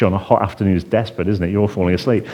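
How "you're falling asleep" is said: ordinary talk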